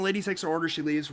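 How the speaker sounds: normal talking voice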